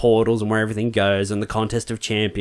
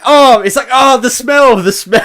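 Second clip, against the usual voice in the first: high, loud disgusted groan; hammed-up disgust, much higher; mock disgust; 13 semitones higher, 7 dB louder than usual. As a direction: recoil from a terrible smell. Thor disgusted by melting man.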